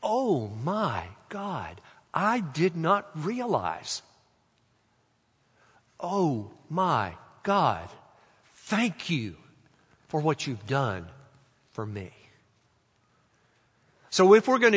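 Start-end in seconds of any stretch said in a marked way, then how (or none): 0.0-4.0 very slow, soft reverence; drawn-out, prayerful discovery; awed realization. Shown as a demonstration. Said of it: "But if you say it". 6.0-12.1 slow, hushed prayer of gratitude; very slow, tender address to God; reverent thanks. Shown as a demonstration. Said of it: "But if you say it".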